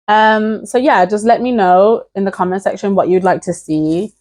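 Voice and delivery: ordinary talk, nothing marked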